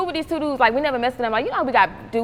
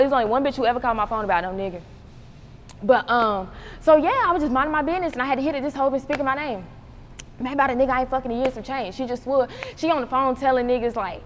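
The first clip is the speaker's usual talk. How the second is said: normal talking voice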